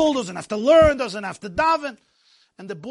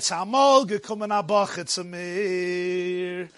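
slow, lower Jerusalem storyteller's lilt; Jerusalem melody; 6 semitones lower, slower than usual. Retold or imitated. Maggid begins his tale of a yeshiva boy, Jerusalem melody. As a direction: draw it out in a Jerusalem Yiddish melody. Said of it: "a Jerusalem melody".

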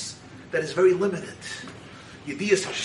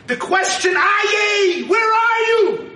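high, loud drawn-out cry; impassioned preaching; 13 semitones higher, 6 dB louder, slower than usual. Real, not acted. Preacher calls out the searching question, crying out. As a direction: call it out far above talking pitch.

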